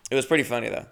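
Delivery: normal talking voice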